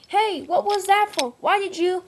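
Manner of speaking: high, loud protest; indignant shout. Acted. Engines yelling at Diesel while falling in. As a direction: yell at the betrayer.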